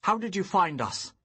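loud, high demand. Acted. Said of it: "I demanded".

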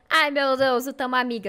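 loud burst of delight. Real, not acted. Streamer has befriended the bunny, loudly delighted. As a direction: exclaim it loudly.

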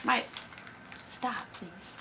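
soft, hushed please to stop; hushed aside. Real, not acted. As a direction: ask someone nearby to stop, under your breath. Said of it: "Make it too much noise".

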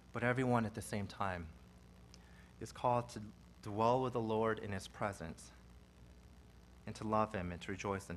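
soft, low and intimate, drawing them in; hushed preaching. Acted. Pastor quietly urging the congregation to trust the Lord, hushed. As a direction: lean in and speak gently to the flock.

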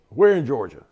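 put-on loud, high Southern-accented officer; strong Southern accent. Commander pressing about Georgia, Southern accent. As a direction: fire the question in imitated Southern accent. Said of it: "in a real strong Southern accent".

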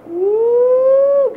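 high, loud celebratory woo; excited whoop. Real, not acted. Dad whooping after a long band practice day, high and loud. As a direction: let out a big whoop of relief.

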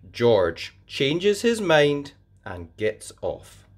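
usual speaking voice

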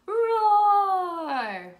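loud, high roar; medium dinosaur roar. Acted. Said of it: "our medium dinosaur".

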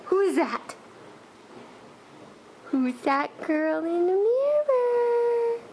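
high, slow, drawn-out cooing; cooing baby talk. Acted. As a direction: coo at the baby in the mirror. Talking to baby at mirror.

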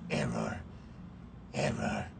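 soft, flat robot error voice; glitching robot. Pretend. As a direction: speak like a failing computer. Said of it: "Why am I even doing the voice?".

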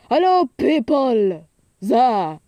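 too loud. Real, not acted. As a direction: holler hello to the crowd. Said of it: "Am I talking too loud?".

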